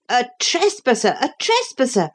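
high-pitched outcry of an accusing crowd; crying crowd. A narrator's reading. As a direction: cry out high, as an outraged mob. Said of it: "cried the crowd".

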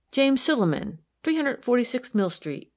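high, ready recital; glib. A narrator's reading. Girl gives officer her man's address, glib. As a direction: rattle off name and address easily. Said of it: "said the girl glibly".

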